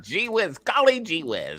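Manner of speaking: put-on high gee-whiz kid voice; wholesome 1950s kid. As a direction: chirp it like an innocent old-TV boy. Echoing the gee-whiz bit.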